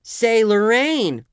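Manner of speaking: high, loud holler; loud calling out. A narrator's reading. Guest to Lorraine wanting a Coke, shrieky holler. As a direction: call across the room for a drink.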